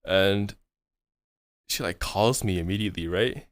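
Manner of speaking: usual speaking voice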